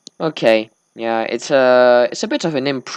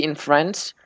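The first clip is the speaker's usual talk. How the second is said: normal talking voice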